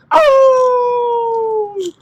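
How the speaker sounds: very high, loud long howl; pained yell. Acted. Wolf stabbed in the foot, high howling yell. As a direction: yelp like a stabbed wolf, drawn out. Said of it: "yelled out".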